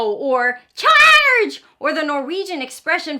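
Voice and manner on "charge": high, loud roaring shout; dinosaur battle cry. Acted. Storyteller to kids, a high dinosaur battle cry. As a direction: yell it like a dinosaur charging in.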